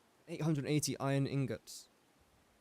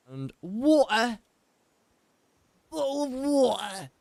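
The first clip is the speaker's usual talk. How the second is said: mock British accent; 8 semitones higher, 5 dB louder, slower than usual. Shown as a demonstration. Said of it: "I am insulting my own language when I say that".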